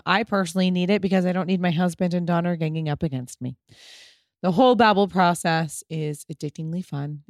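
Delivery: soft, steady sponsor read. Acted. Host reading a Babbel sponsor spot, calm ad voice. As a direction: read the ad like a friendly pitch.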